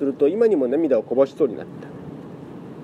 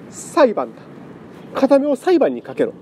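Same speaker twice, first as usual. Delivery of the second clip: shrill excited outburst; high and keyed up; 10 semitones higher than usual. Acted. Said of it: "お年種は興奮して".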